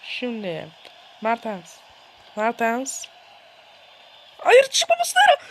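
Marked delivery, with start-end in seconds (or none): 4.4-5.4 sudden high, loud cry; panicked shout. Real, not acted. Gamer shouting at his keeper not to come out, high panicked cry. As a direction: yell no at the screen.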